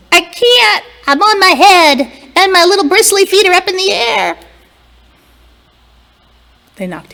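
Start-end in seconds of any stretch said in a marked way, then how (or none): 0.1-4.4 high, loud squeaky broom; upside-down broom voice. A narrator's reading. The upside-down broom protests to the fairies, high and loud. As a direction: protest from on its head. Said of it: "The broom said".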